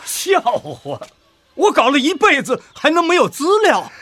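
as if the question were an insult; indignant scoff. Acted. Professor scoffs at Shen for asking about his research records, indignant.

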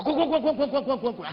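high, loud string of get-outs; repeated angry shouting. Retold or imitated. Father driving the boyfriend out, repeated furious shouts. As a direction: hammer each word, chasing him off. Father chasing him away.

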